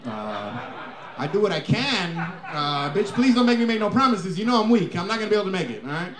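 lightly voiced Mexican dad, mumbling and slow in places. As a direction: play the dad dodging the question with a chuckle. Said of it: "He said".

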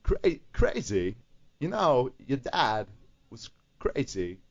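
halting, slow New York Walken impression; slow, broken New York accent with long pauses. Shown as a demonstration. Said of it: "add a little bit of a New York accent".

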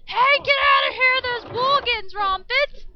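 high, loud warning yell; urgent shout. Pretend. Player warning game villagers to flee, loud urgent shout. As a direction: yell to get them out fast.

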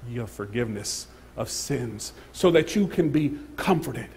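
ordinary talk

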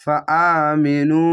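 ফা আমিনু linked slowly, no pause; joined recitation. Shown as a demonstration. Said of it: "এভাবে মিলিয়ে পড়বেন".

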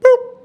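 squeaky high boop sound effect; sudden high boop. Pretend. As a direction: pop it out like a bubble bursting. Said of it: "it was like".

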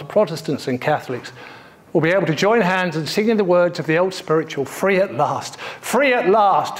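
a touch of King's sermon cadence; building oratory. Retold or imitated. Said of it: "You bet it does".

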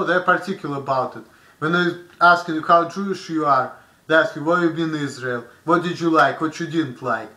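ordinary talk

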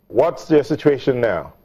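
normal talking voice

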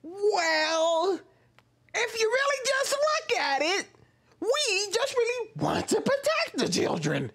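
very high, slow parody voice; put-on character voice. Mimicking the creator wanting to protect children, exaggerated character voice. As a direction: drag it out sky-high, mocking a video creator. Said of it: "it's a character voice".

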